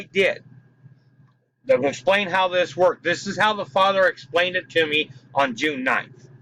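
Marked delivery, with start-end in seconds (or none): none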